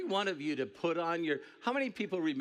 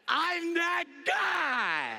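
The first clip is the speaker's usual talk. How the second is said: drawn-out triumphant shout, much higher and louder; shouted punchline; 14 semitones higher, 5 dB louder, much slower than usual. Real, not acted. Speaker to the crowd, proudly shouting he is the top guy. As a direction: bellow it out slow and proud like the boss.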